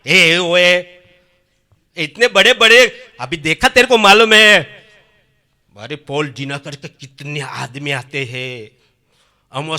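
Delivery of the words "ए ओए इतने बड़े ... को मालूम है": retold shouting. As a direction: yell at him like the angry son. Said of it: "कितना चिल्लाता है". (बेटा गुस्से में पिता पर चिल्लाता है)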